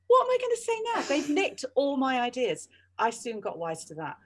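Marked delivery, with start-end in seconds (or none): 0.1-2.7 high-pitched mock panic, a bit louder. At the back of the queue, her ideas gone, mock panic. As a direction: squeak it out as though all your ideas have just been stolen.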